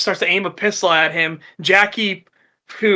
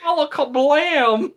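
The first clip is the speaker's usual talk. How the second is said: higher, slow, goofy "Alakablam"; silly drawn-out gunshot word; 9 semitones higher, slower than usual. Retold or imitated. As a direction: stretch it like a cartoon cast spell. Redneck fires one-handed.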